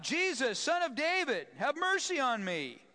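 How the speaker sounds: high, loud cry for mercy; desperate call. Acted. Blind man crying out to Jesus, loud plea. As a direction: call out across the crowd, pleading. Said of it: "So he called out".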